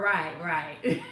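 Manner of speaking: laughing through "right, right". Real, not acted. Hostess laughing along with a viewer, real laughter.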